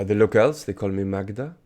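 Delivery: put-on the French accent toned down; non-French accent. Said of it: "say that in a non-French accent".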